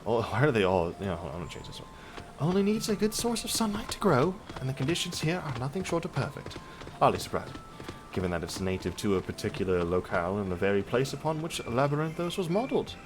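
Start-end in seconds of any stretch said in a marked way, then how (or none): 2.4-12.9 lightly voiced researcher, starting higher; higher opening settling into measured explanation; game character. Reads game character's dialogue.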